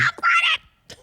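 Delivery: very high, slow giddy squeal; excited squeal. Real, not acted. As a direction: stretch it out in a thrilled squeal. High drawn-out fan squeal.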